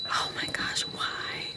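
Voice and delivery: breathless whispered why, why, why; horrified whisper. Real, not acted. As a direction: plead under your breath. Whispered horror.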